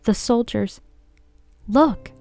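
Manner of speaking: startled outcry; cried out. A narrator's reading. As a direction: cry out in alarm at the table. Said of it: "She cried out".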